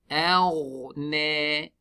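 أغنى with ghain unsmiled, slow and drawn; ghain without smiling. Shown as a demonstration. Teacher demonstrating أغنى to students, slow careful recitation. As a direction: say أغنى slowly, lips neutral on the ghain. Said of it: "we don't smile on the ghain".

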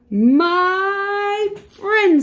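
high, drawn-out joyful address; delighted fish. Acted. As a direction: stretch it out high with storybook delight. Fish delighted after the kiss.

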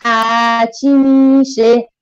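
slow, drawn-out classroom question; slow teacher prompt. Shown as a demonstration. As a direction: drag the words out for the class. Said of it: "با هم بخونیم".

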